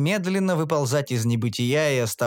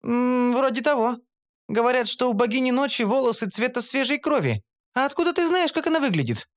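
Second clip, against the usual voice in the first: much higher, suspicious question at the end; curious Shantri; 10 semitones higher than usual. A narrator's reading. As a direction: ask how he knows. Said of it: "поинтересовалась Шантри". (Шантри с подозрением интересуется)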